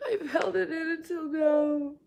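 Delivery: real tears, voice breaking high and whispered; crying. Real, not acted. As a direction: let the held-in grief spill out in a teary whisper. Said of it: "just let it out".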